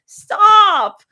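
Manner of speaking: high, loud protest; embarrassed yell. Acted. Embarrassed girl yelling at friends who call her cute. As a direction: cut off their teasing, loud and high.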